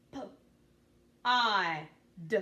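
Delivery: "P. I. D." as separate sounds p, ah, d; sounded out. Shown as a demonstration. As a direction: sound out "P. I. D." with gaps. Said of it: "Tell me the sounds in pod".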